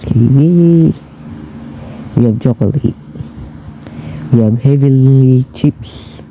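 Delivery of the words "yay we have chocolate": ordinary talk, nothing marked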